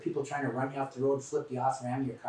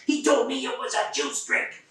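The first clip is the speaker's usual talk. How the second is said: much higher, slow and loud indignant outburst; spitting fury; 11 semitones higher, 5 dB louder than usual. Retold or imitated. Grandmother in the narrator's face, much higher, slow and furious. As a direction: spit the words out right in his face. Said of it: "practically spitting".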